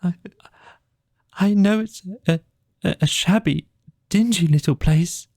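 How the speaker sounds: broken, tearful Mole; sobbing brokenly. Acted. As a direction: stammer it out through sobs. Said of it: "he sobbed forth at last brokenly".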